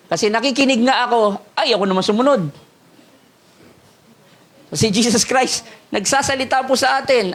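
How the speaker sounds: ordinary talk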